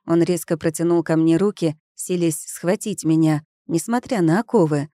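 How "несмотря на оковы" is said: ordinary delivery, nothing marked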